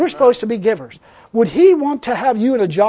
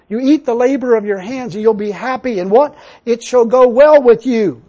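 preaching; 3 semitones higher, 6 dB louder than usual. Acted. Preacher driving home the promise to the church, building and loud.

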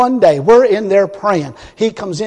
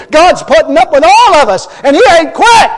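loud, high revival preaching; fiery preaching; 7 semitones higher, 8 dB louder than usual. Acted. Preacher mocks the minister to the congregation, loud and fiery. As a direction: thunder it from the pulpit.

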